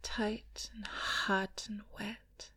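breathy intimate whisper, close to the mic; sensual ASMR whisper. Acted.

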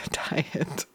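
whispered correction. Real, not acted. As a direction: whisper the word to fix it. Corrects word after laughing.

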